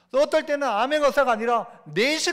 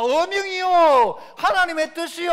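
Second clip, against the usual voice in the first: high, slow, louder proclamation; royal decree call; 6 semitones higher, 5 dB louder than usual. Acted. Preacher proclaiming God's will as a royal decree. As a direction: stretch out the decree like a herald. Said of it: "하면은".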